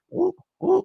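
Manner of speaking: two quick high whoops. Real, not acted. Reacting to Mitch's play on the highlight, high whoops. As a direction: let out a very high-pitched double whoop.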